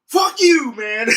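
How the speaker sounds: re-enacted high, loud yell; defiant outburst. Worker backed by the manager yelling at the customer, loudly defiant. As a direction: shout it out, ending on a laugh.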